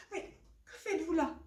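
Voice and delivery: high, alarmed question; frightened. Acted. Mother fish sees the crow come in, frightened. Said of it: "elle a peur".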